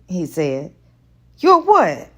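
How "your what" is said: high, loud startled "Your what?"; surprised question. A narrator's reading. Woman startled by his teasing, high and loud. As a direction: catch the innuendo, startled. Playful double meaning confusion.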